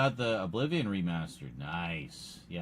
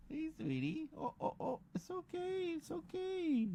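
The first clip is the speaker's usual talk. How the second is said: soft pet voice; 12 dB quieter than usual. Real, not acted. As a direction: coo gently to a cat. Soothing the cat.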